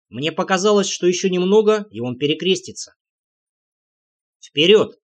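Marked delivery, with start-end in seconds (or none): 4.4-5.0 loud one-word order, firm ending; commanding call. A narrator's reading. Leader ordering his party onward, commanding call. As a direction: give Вперёд as a loud command.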